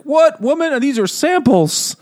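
very high, drawn-out protest; mock indignant denial. Willie denying it to the mistress before his son, mock indignant. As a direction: protest loud and high, acting caught out.

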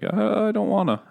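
high, lightly voiced old man. Old man delivering the father's letters, high and fussy. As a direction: play a fussy old messenger, starting high.

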